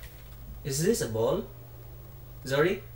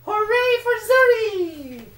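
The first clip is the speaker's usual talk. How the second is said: excited cheering; 7 dB louder, much slower than usual. Real, not acted. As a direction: cheer them on with delight. Cheering the toddler's success.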